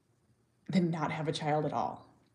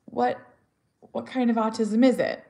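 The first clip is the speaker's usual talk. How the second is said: higher, louder, curious asking; curious stranger; 5 semitones higher, 5 dB louder than usual. Retold or imitated. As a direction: ask it like a kind stranger. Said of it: "they'll say something like".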